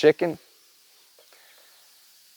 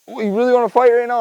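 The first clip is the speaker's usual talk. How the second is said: higher, squaring up to a rooster; daring the bird to fight, playfully; taunting a rooster, half laughing at himself; mock challenge; 9 semitones higher, 4 dB louder than usual. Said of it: "I'm like".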